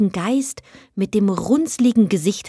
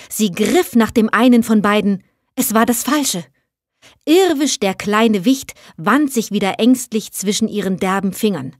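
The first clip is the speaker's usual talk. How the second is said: ordinary delivery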